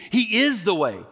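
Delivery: "is" hit high and falling in He is the way; stress on is. Acted. Preacher to congregation, stress on "is". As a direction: press the word "is". Contrast: not showing, is the way.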